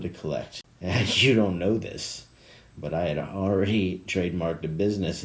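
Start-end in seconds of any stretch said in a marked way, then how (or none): none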